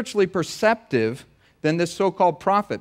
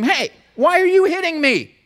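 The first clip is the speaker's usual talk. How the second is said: squeaky high cartoon donkey, a bit louder; 13 semitones higher, 5 dB louder than usual. Talking donkey complains to Balaam, high cartoon donkey voice. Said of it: "It's the voice of Eddie Murphy".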